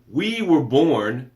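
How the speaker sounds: slow, weighty, every word held out; slow emphatic. Shown as a demonstration. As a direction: land it slowly for weight. Repeats line, then slows it.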